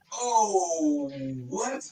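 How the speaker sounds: stunned surprise. Retold or imitated. Recognizing the man he mocked.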